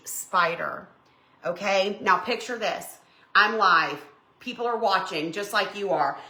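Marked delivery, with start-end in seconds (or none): none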